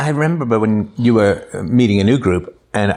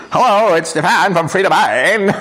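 mock announcer voice; 7 semitones higher, slower than usual.

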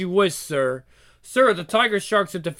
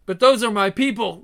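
ordinary talk

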